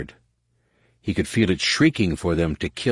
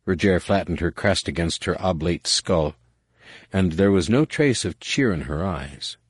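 plain reading voice

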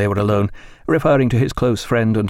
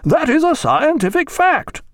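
very high, slow, emphatic declaration; louder, insistent; 3 dB louder than usual. A narrator's reading. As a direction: pronounce it as indisputable, voice raised. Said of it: "rather louder".

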